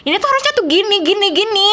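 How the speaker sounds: high, firm, insistent correction; Aceh-style anger. Shown as a demonstration. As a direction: lay down the rule sharply. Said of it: "udah bisa niru orang Aceh kalau marah".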